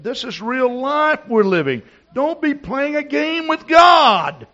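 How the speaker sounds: high, booming shouted warning; thunderous pulpit cry, pitched very high; full-force preaching shout. Real, not acted. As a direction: shout it at the congregation, stretching the last words.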